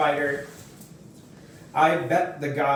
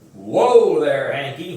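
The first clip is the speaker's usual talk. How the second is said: warm grandpa; 7 dB louder than usual. A narrator's reading. Grandpa calms scared boy.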